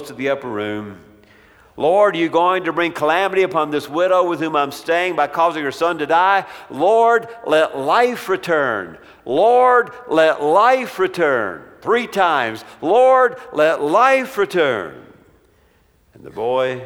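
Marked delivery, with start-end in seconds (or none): 1.8-11.6 pleading prayer. Acted. Elijah praying over dead son. 12.8-15.0 each "Lord" cried out high and loud, then "let life return" slow; pleading prayer. Acted. Third cry of the prayer.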